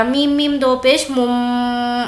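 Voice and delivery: slow letter-by-letter spelling of میم میم دو پیش مم; spelled out slowly. Shown as a demonstration. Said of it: "اس کو اس طریقے سے پڑھا جائے گا". (آہستہ ہجے کر کے)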